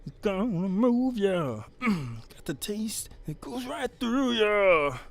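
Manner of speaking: high, loud put-on dumpster creature voice; Pukey Pete voice. Streamer greets chat as Pukey Pete, high and loud. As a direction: belt it out high as the dumpster guy. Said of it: "I'm still trying to figure out the voice for Pukey".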